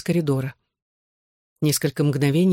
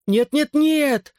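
high, drawn-out pleading protest; loud protest; 11 semitones higher, much slower than usual. A narrator's reading. Groggy boy refusing to lose his visit, high protest. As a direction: wail the refusal, stretching each word. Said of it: "запротестовал я".

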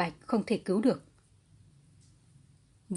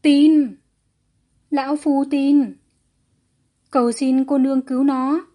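loud, higher desperate cry; desperate pleading; 5 semitones higher, 8 dB louder than usual. A narrator's reading. Kneeling father begging her to save his son, desperate pleading. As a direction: beg as if clutching a last straw. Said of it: "giống như người sắp chết đuối".

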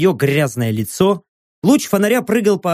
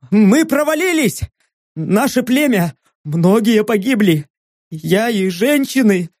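panicked pleading; 8 semitones higher, slower than usual. Acted. Hero plays panicked goblin on phone.

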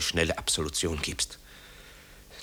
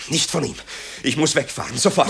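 panicked cry; 7 semitones higher, 3 dB louder than usual. Acted. Marco panicking to his mother, high frantic cry.

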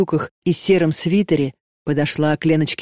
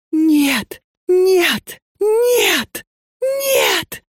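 each no higher, sobbing and screaming; rising crying scream; 13 semitones higher, much slower than usual. A narrator's reading. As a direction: break into a full crying scream. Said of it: "прорезался голос".

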